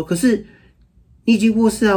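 put-on high child protest. Father voicing his son's protest, high and childlike. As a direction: object like a worried little boy. Father voices son objecting.

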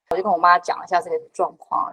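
ordinary talk

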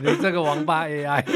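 loud laughing jab; laughing insult. Real, not acted. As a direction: yell it through laughter. Mock insult while laughing.

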